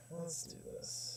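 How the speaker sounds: soft unvoiced whisper. Real, not acted. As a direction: breathe it out in a whisper. Repeats it in a whisper.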